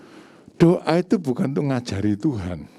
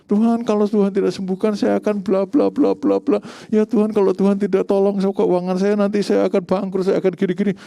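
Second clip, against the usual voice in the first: high, droning mimicked plea; mock bossy prayer; 8 semitones higher, 4 dB louder than usual. Preacher mimics people lecturing Tuhan in prayer, high mock pleading. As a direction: rattle off demands to God in a raised voice. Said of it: "saya dengar orang doa itu doanya ngajari Tuhan".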